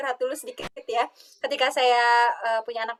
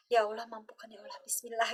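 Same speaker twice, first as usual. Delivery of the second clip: quiet prayer; 9 dB quieter than usual. Retold or imitated.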